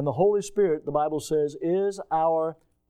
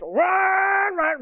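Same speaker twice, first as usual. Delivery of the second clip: mock engine roar; 12 semitones higher than usual. Imitating the roaring engine.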